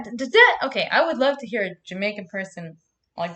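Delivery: normal talking voice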